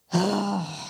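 long weary sigh; exasperated sigh. Retold or imitated. Mom retelling the milk trip: weary exasperated sigh. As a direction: let out a tired parent's sigh.

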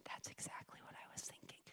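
barely audible, breathy whisper; whispered quote. Retold or imitated. The lady answering the preacher over message, whispered. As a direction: let it fall to a hush. Lady's hushed reply retold.

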